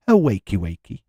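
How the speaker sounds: put-on Scouse accent greeting; a light Liverpool Scouse accent. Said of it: "Gotta do it in a Scouse accent".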